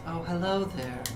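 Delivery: polite robotic announcer; calm synthetic lab voice; automated voice. Acted. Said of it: "That was good".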